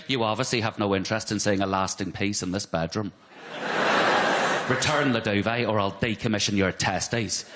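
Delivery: low, measured Northern Irish ultimatum; mock political hardliner in a Northern Irish accent; imitated Northern Irish wife. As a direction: deliver a stern Northern Irish threat, low. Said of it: "She's immediately going".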